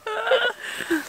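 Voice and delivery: a high giggle; high laugh. Real, not acted. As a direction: giggle helplessly. Laughing at headlamps.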